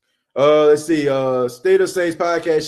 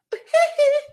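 high squeal of laughter. Real, not acted. Laughing at own impression.

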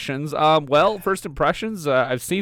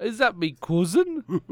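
slow, higher, laughing Northern voice; mock Northern accent; 6 semitones higher, slower than usual. Co-host joking in a Northern accent about his cousin. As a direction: chuckle through a put-on Northern accent. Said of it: "that perfect northern accent".

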